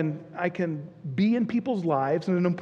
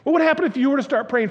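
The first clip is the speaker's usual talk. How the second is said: higher, louder and faster; building preacher's call; 7 semitones higher, 5 dB louder, faster than usual. Real, not acted. Pastor presses the congregation again, now rising and louder. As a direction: push the question out with fervour.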